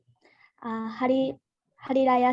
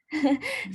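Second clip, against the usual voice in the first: short laugh; 5 semitones higher than usual. Real, not acted. Laughs at own stumble.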